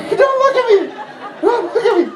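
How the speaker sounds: very high, squeaky mock panic; panicked plea. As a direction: squeal it as if caught exposed. Acted panic, caught on the toilet.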